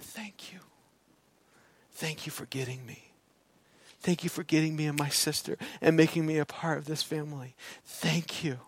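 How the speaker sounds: very soft, hushed retelling; tender thanks. Retold or imitated. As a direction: quietly voice a son's heartfelt gratitude. Retelling his son's heartfelt thanks.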